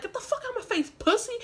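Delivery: high-pitched re-enacted insult; acted-out squabbling, not shouted; angry taunt. Acted quarrel with big guy.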